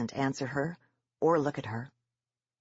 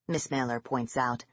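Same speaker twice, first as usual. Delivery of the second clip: ordinary delivery, nothing marked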